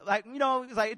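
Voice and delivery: usual speaking voice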